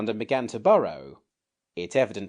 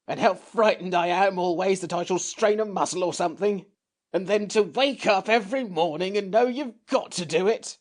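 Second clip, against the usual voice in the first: higher, louder creature voice near tears; tearful creature; 11 semitones higher, 4 dB louder than usual. A narrator's reading. The Samiad begs to be spared, tearful creature. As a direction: plead as if about to cry. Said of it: "in a tearful voice".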